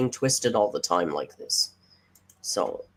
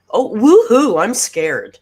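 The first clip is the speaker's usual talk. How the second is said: high, loud sarcastic woohoo; mock her dismissive taunt; sneering pretend fright; mocking fake fear; 7 semitones higher, 11 dB louder, slower than usual. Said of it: "her response to that was".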